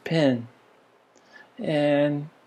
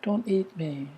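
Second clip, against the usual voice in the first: pleading cow; 3 semitones higher, 7 dB quieter than usual. Retold or imitated. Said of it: "the pleading was in the voice".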